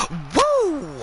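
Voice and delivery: gasping lead-in to a falsetto woo; Flair woo. Acted. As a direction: snap into a ringing woo. Voicing Flair after a gasp.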